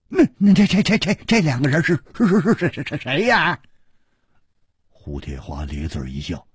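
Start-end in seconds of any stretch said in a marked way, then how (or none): 0.1-3.6 high, stuttering panicky voice; a scared bystander stutters his question; frightened stammer. Acted. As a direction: stammer it out in fear.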